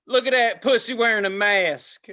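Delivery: loud, jeering taunt; mocking heckler. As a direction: call it out like a heckler. Acting out a heckler mocking him.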